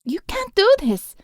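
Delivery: high, pleading cry; frightened protest. A narrator's reading. Alma protests to the thug, high and scared. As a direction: push back through fear, high.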